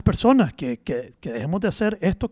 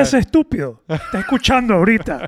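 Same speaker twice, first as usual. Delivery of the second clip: laughing taunt; 4 semitones higher, 6 dB louder than usual. Pretend. Teasing the absent critic.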